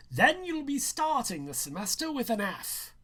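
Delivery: high, loud threat in a teacher's voice; stern teacher. Acted. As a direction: warn him off with an F, loudly.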